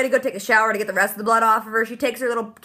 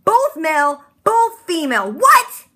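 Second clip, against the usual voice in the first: very high, loud rising cry; shocked disbelief; 6 semitones higher, 5 dB louder, slower than usual. Real, not acted. Vlogger stunned by the clone twist, a high loud disbelieving cry. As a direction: shriek the question in disbelief.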